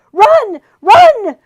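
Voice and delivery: high loud urgent shout; urgent shouted warning; crying out. A narrator's reading. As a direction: yell a warning. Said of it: "a voice cried out".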